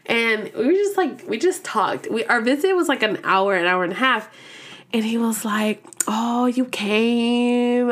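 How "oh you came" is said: very slow, stretched-out words; retold line, dragged out lazily; drawn-out greeting. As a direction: imitate his lingering, slow greeting. He greets her at the visit.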